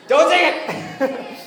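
high, loud, drawn-out yell; playful shouted protest. Real, not acted. Speaker scolding the crowd over a joke, shouted. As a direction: shout it out, breaking into a laugh.

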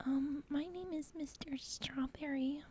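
slow, soft whisper; ASMR whisper. Pretend. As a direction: whisper an introduction in mock ASMR. ASMR spoof, spelled out just before.

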